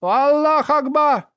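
громкий протяжный крик тонущего; выкрикнуть высоко и громко. A narrator's reading. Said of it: "скрылся в волнах с криком". (отчаянный крик)

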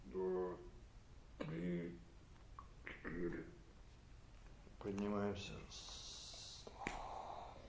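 soft breathy whisper; whispered counting. Real, not acted. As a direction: whisper the count during the hold. Counting during breath hold.

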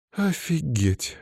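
high, slow amazed woman's voice; drawn-out, high-pitched disbelief; stunned exhale. A narrator's reading. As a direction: breathe it out in astonishment. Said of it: "выдохнула Лиза".

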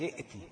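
ائتي with the hamza sounded, soft; the rejected way to say ائتي; wrong reading. Shown as a demonstration. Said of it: "لا، مش". (النطق الخطأ ائتي)

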